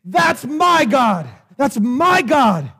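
slow, wholehearted preacher's shout; like you mean it. Shown as a demonstration. Preacher showing congregation how to declare it, shouting like he means it. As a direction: shout it with full conviction, word by word. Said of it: "Say it like you mean it".

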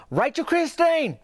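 high, drawn-out yell of the name. Retold or imitated. Meemaw yelling for her stolen doll. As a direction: holler it slowly across the yard. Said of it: "she yelled".

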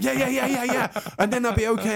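high-pitched, hurried string of agreement; frantic agreeing. Retold or imitated. Comedian retelling how he agreed with the gangster, frantic agreeing.

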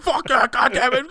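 hammed-up fury at the movie; mock angry outburst. Friend mad the movie just ended, mock angry cursing.

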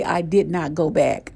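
usual speaking voice

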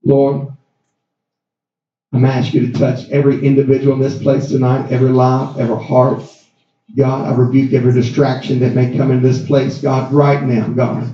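low, urgent pastor's prayer; fervent prayer. Acted. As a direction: pray over the church, pressing on each God. Prays over the congregation.

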